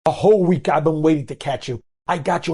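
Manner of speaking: high-pitched, indignant accusation; accusing outburst. Retold or imitated. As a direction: act out catching a thief red-handed. Cashier publicly accuses a shopper.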